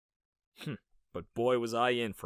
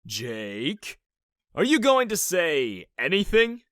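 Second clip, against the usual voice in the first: drill sergeant; 6 semitones higher, 7 dB louder, slower than usual. Acted. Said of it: "more like a drill sergeant".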